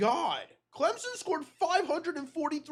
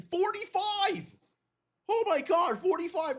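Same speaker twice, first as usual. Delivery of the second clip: shocked exclamation; 6 semitones higher than usual. Real, not acted. Discovers another huge score.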